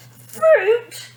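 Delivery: high, loud furious cry; a spoilt girl yelling at her parents; furious shout. A narrator's reading. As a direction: explode at the word. Tantrum building.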